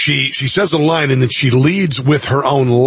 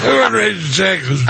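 higher, mocking repeat of her answer; mocking slurred echo; 5 semitones higher than usual. Host mocking the candidate's answer, slurred echo. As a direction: parrot her drowsily to ridicule it. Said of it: "repeats her slurred answer".

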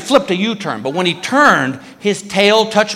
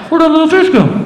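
mock alarm; 10 semitones higher, 5 dB louder, faster than usual. Scared question in story.

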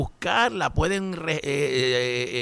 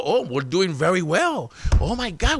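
mocking Trump fans; 4 semitones higher than usual. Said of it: "Fox News pone ese audio y los trompitos".

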